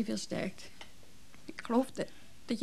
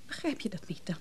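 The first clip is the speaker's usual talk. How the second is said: sharp voiceless whisper; 4 semitones lower, 12 dB quieter than usual. Acted. Wife whispering reproach at Johan during the row. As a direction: hiss the question under the breath. Tense quarrel between a couple.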